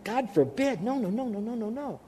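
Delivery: dwindling no no no; emphatic refusal. Retold or imitated. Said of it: "And he says the same thing".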